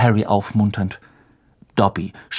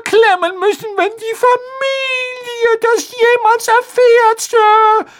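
very high squeaky elf voice, close to tears; a terrified little servant dreading punishment; fearful house-elf; 5 dB louder than usual. A narrator's reading.

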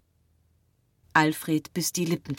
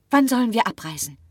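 high, quick, eager question; 7 semitones higher, 5 dB louder, faster than usual. A narrator's reading. Marie asks the doctor about leaving, eagerly. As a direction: ask it in a rush, keen to go. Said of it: "fragte Marie eifrig".

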